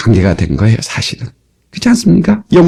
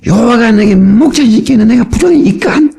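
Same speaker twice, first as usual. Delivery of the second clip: higher-pitched imitated Pyongyang dialect; 8 semitones higher than usual. Preacher repeats the psalm for his congregation in higher Pyongyang dialect. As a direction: recite in lifted, sing-out Pyongyang dialect. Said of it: "평양도 말로 해도".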